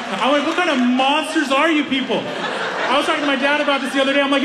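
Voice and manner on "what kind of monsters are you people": high, laughing shout of disbelief; laughing, half-shouted mock disgust; laughing mock outrage. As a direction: scold them through laughter, much higher.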